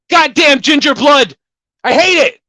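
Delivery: high, loud mock outburst; hammed-up yell. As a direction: ham up a loud fit of rage. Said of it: "so I started yelling".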